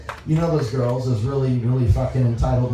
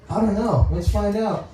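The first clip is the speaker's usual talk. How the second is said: higher, amused parent voice; raised-pitch family quote; mock mom; 7 semitones higher than usual. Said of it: "my mom and brother are like".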